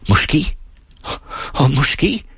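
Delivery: high, hopeful echo; eager questioning. A narrator's reading. Singer presses the ferryman's faint concession, high and eager. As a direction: seize on the word, pitched high. Orpheus seizes on the maybe.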